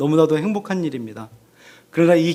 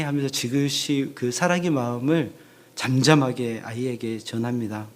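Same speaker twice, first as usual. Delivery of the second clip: normal talking voice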